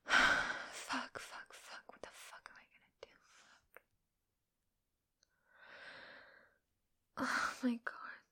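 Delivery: unvoiced whispered swearing to herself; whispering to herself in a panic about the grade. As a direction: hiss the curses under your breath.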